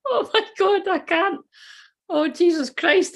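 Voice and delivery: high, breathless with laughter; can barely speak for laughing; giggling disbelief; laughing helplessly. Real, not acted. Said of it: "I'm in hysterics".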